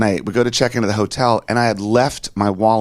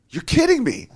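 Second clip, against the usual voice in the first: retold amazement. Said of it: "I'm like".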